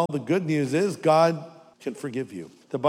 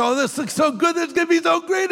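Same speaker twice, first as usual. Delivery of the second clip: much higher, gushing mock delight; 14 semitones higher, faster than usual. Preacher mocking a greedy candy bite, with gushing delight. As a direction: gush over the candy like a fool.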